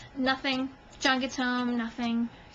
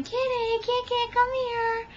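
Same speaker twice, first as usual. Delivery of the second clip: mock yelling for cat; 11 semitones higher than usual. Woman retelling her search with a squeaky toy, high mock yell. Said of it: "squeaking her toy and yelling".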